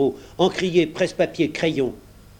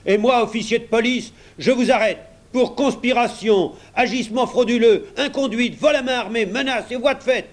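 shouted charges; 9 semitones higher than usual. A narrator's reading. Policeman arrests the clerk, shouting.

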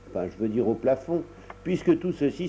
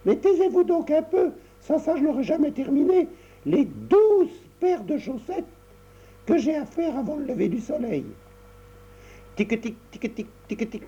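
put-on busy little girl; 14 semitones higher than usual. Tricotta hushes her mother.

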